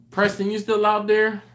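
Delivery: loud, high call to someone far off. Real, not acted. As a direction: call out across the room. Calling out to a viewer.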